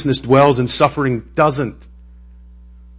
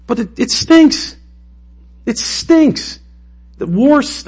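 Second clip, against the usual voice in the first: whiny protest; 13 semitones higher, 3 dB louder, slower than usual. Real, not acted. Grieving war deaths, exasperated.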